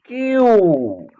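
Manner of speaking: क्यू with a long, held oo; letter pronunciation. Shown as a demonstration. Teacher showing how Q is said, क्यू drawn out. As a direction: stretch the end of क्यू. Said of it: "तो इसका उच्चारण करेंगे".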